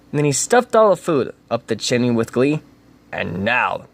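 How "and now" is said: grinning Grinch. A narrator's reading. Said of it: "grinned the Grinch".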